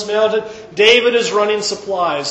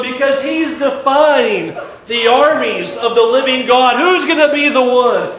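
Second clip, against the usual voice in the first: urgent, higher and louder questions; rising indignation; 6 semitones higher, 5 dB louder than usual. Acted. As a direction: press the question harder each time. David asks, growing indignant.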